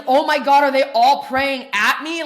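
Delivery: retold panic in the circle; mock panic. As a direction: paranoid disbelief. Retells paranoid thought.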